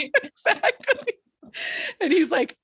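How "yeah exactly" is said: high, slowed words through laughter; laughing agreement. Real, not acted. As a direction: agree while cracking up. Cracking up in agreement.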